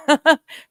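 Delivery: short loud laugh; burst of laughter. Real, not acted. Laughs at own plan.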